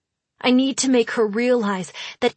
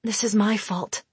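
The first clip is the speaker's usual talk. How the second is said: ordinary delivery, nothing marked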